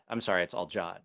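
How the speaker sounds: ordinary delivery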